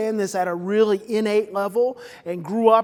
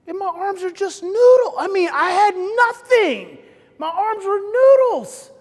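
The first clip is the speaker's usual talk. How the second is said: intense; 7 semitones higher, 8 dB louder than usual. Real, not acted. Recalling total collapse.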